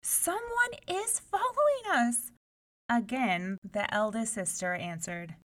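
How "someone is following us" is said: much higher, scared warning; high-pitched frightened plea; frightened cry. A narrator's reading. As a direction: insist to the sisters in fear. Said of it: "she cried".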